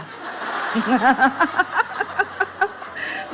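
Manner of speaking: helpless drawn-out giggling at own joke; laughing. Real, not acted. Speaker laughing after comparing car to old widow, amused laugh.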